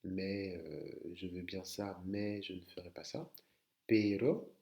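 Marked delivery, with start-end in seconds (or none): 3.9-4.5 PERO with one short R tap; R simple. Shown as a demonstration. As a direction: model pero, rising, without trilling. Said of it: "un simple R". (pero au R non roulé)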